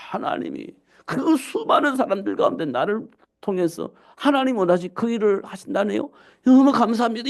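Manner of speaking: high, marvelling thanks; overwhelmed thankfulness, pitched high; amazed gratitude. Acted. Acts out amazed thanks to God.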